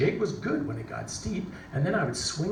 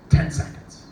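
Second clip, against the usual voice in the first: official's call; 8 dB louder than usual. Retold or imitated. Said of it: "So the race official goes".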